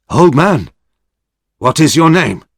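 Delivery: a hint of a stern warrior demanding; lightly voiced stern samurai. A narrator's reading. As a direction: ask it firmly. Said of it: "said Hazama".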